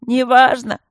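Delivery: loud, high, tearful cutoff; tearful snap. A narrator's reading. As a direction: snap it through tears. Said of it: "слезливо отрезал голосящий".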